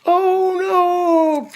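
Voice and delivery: high loud oh no; shocked cry. Acted. Dad crying out as the plate slips.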